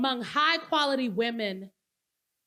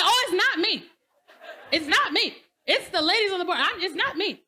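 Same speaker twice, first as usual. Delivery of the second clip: Lauren voiced; 6 semitones higher, 3 dB louder than usual. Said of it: "And she's like".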